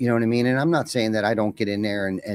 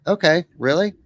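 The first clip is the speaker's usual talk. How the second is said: higher-pitched, questioning surprise; surprised reply; 8 semitones higher, 3 dB louder than usual. Retold or imitated. Gentleman told he gets to decide, mildly surprised. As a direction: imitate someone taken aback, pitch lifted. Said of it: "tell by the tone in his voice".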